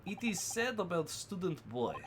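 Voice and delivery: lightly put-on Russian accent, broken English; a hint of a gruff Russian sailor guarding the cabins. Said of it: "same Russian voice".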